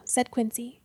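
usual speaking voice

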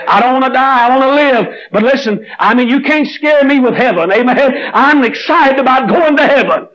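ringing joyful pulpit cry; fervent preaching. Acted. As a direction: lift it up like a revival call. Preacher rising to full force.

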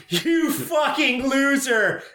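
high, drawn-out mock jeer; heckling reaction. Friend heckles the cinema screen, high mocking jeer. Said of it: "Old School's reaction".

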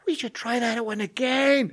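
high, slow deafened yell; still yelling. Retold or imitated. Teacher to shaken kid after the blast, yelling. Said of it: "I'm still yelling".

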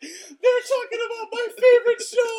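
high, loud excited fan squeal. Retold or imitated. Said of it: "They're like".

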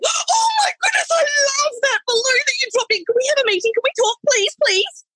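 very high, squealing delight; gushing excitement. Retold or imitated. Marketing manager's email read as a high, gushing squeal. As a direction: gush like a thrilled fan. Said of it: "I got an email ... going".

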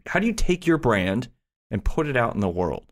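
ordinary talk

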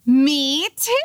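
high, squealed retold reply; excited confession. Girlfriend owning up to boyfriend at a party, high and excited. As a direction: burst out with it in mock-giddy relief.

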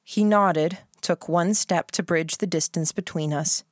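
usual speaking voice

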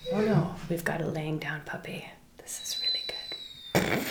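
soft unvoiced whisper; whispering over a resting puppy. Real, not acted. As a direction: hush so the dog stays down. Not waking lying puppy.

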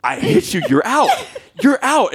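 high-pitched argumentative yell, retold; mock yelling. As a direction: retell yelling at a kid, pitch way up. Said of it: "Then I'm yelling at your sixth grade son".

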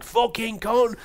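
high-pitched imitated curse; mock furious yell. Villain cursing before he runs after her, mock furious yell. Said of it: "He's like".

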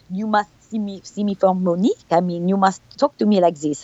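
put-on French accent. Actress pushing the director to see her as Monique, French accent. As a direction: insist in a thick French accent. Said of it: "talk to him with my French accent".

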